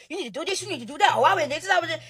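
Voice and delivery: high, hushed mimicry of busy hypers; mocking quick-fire chatter, soft and high-pitched; egging-on commenters. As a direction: imitate a crowd egging you on, small and high. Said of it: "Y'all in the comments hyping me up".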